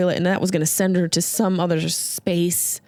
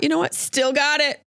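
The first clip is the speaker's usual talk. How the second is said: smug pride; 7 semitones higher than usual. Retold or imitated. Smug self-congratulation after compliment.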